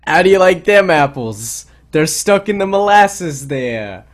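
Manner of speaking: put-on slow, loud Boston accent. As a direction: lay on a thick Boston accent. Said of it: "you know how they sound in Boston".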